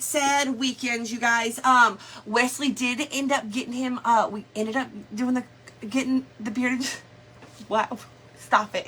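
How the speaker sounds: normal talking voice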